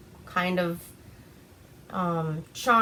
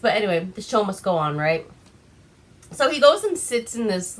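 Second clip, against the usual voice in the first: ordinary talk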